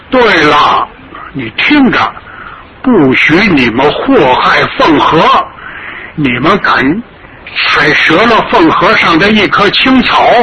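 put-on an old man's fierce, defiant warning; defiant grandfather. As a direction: threaten them with every word. Grandfather threatens the invaders.

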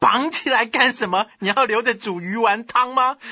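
loud, high, exasperated questions; scolding retort. Acted. Commander rejecting his subordinate's timid idea, loud scolding. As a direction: jump on the suggestion with scorn.

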